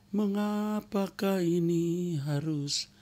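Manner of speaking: high, slow, drawn-out lament; whiny complaint. Acted. Preacher mimics a believer complaining to God, slow high whine.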